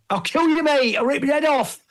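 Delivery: high, hammed-up Nottingham yell; Nottingham accent. A-Bomb losing it at the Hulk, mad Nottingham accent. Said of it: "I just imagine him in the Nottingham".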